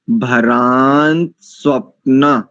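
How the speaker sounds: भरान स्वप्न slow, stress on भरान. Shown as a demonstration. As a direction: spell out भरान स्वप्न slowly so no letter is lost. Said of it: "और धीमी गति से देखिए".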